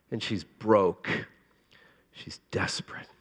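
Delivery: whispered for weight; hushed. Real, not acted. Pastor whispering about the bleeding woman. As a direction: drop to a breath to land it. Sermon climax, hushed line.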